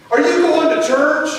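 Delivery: put-on a wide-awake kid with a question; curious child. Son asks dad.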